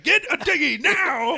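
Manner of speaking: high-pitched imitation of a megaphone order; mock megaphone yell. Cop on megaphone to men on shore, mock megaphone yell. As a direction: call it out as if through a megaphone. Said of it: "he's on this fucking megaphone just going like".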